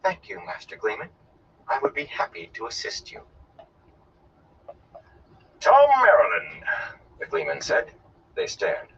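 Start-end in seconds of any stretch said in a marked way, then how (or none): 0.0-3.2 soft, controlled politeness; hushed, carefully even young woman; too calm. Acted. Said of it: "spoke in a too calm voice". 5.6-6.7 high, loud, slow announcement; ringing theatrical self-introduction; grand proclamation. Acted. Gleeman proclaims his name.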